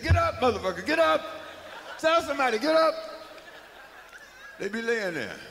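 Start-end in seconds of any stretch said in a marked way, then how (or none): 0.0-2.9 high, loud, laughing shout; yelling at the screen. Retold or imitated. Audience yells at the movie victim, loud and laughing. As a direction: holler at the fallen victim. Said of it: "We be saying".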